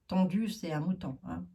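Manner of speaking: usual speaking voice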